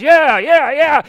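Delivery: high, loud yeah yeah yeah; team cheer. Retold or imitated. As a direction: cheer like a locker room crowd. Teammates cheer along.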